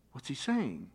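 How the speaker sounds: ordinary talk, nothing marked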